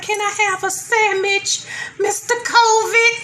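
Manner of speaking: high, slowed, timid begging; pleading high voice. Retold or imitated. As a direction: ask meekly and drawn out, high. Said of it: "It's like".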